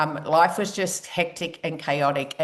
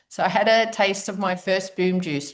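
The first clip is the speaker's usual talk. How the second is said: ordinary delivery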